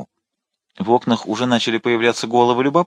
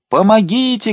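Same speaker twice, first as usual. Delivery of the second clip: much higher shriek; the old servant shrieks; cry for help; 12 semitones higher, 4 dB louder than usual. A narrator's reading. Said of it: "кричала старая служанка". (старая служанка кричит)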